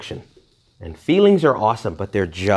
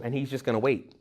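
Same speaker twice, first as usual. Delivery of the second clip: usual speaking voice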